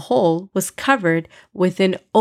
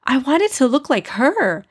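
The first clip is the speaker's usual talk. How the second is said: upset; 6 semitones higher than usual. Acted. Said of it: "Amina said, upset".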